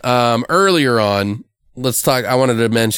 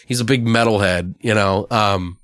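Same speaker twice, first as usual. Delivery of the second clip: ordinary talk, nothing marked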